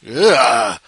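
loud disgusted grunt; disgusted ugh. Real, not acted. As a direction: recoil at something gross. Disgust at old water bottle.

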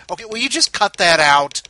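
loud, fed-up telling off; exasperated scolding. Acted. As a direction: snap at them to stop. Scolding listeners.

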